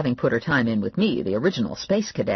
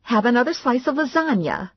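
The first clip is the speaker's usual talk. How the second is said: mother's voice; 10 semitones higher than usual. A narrator's reading. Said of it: "my mother said".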